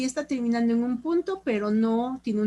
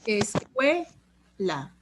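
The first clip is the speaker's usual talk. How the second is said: "Escuela" very slowly in separate syllables, long "cue"; stress on CUE; much slower than usual. Shown as a demonstration. Teacher prompting class for tonic syllable, "Escuela" very slow, stressing "cue". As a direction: pull "Escuela" right apart around a stressed "cue". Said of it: "cue es la sílaba tónica".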